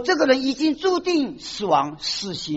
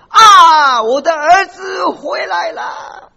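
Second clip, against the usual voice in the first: sobbing shout, slow and much higher: 我的儿子回来了 drawn out; father's tearful cry of joy; 7 semitones higher, 9 dB louder, much slower than usual. Acted. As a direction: cry out through tears like a father whose lost son appears. Father sees prodigal son return. (父亲喜极而泣地大声呼喊)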